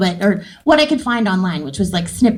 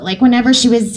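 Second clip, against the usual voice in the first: usual speaking voice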